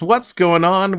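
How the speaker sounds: ordinary talk, nothing marked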